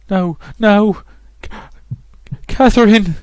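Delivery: high, gasping cries for help; acted breathless panic, pitched high; panicked plea. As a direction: struggle to breathe between words, rising to a cry. Dying character pleads for help.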